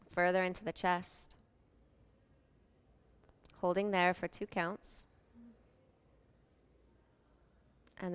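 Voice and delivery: calm, unhurried meditation voice with long pauses; soothing yoga nidra guidance, evenly paced; guided meditation. Real, not acted.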